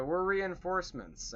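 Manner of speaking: high-pitched mock call to faraway orcs; yelling across distance. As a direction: call it out, half-voiced yell. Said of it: "they're yelling at each other because of how far".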